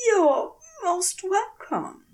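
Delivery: a nervous, overly polite thank-you, a little shaky; slightly wobbly voice. A narrator's reading. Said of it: "Aziraphale's own voice is slightly wobbly".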